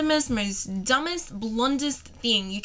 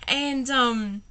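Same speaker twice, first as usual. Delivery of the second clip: usual speaking voice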